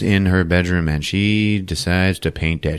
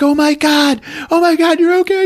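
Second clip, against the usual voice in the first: very high, loud mock cry of relief; hammed-up overjoyed wail; imitated crying out; 5 dB louder than usual. Wife running to her returned husband, mock crying out. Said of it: "she cries out".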